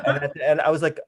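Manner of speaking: ordinary talk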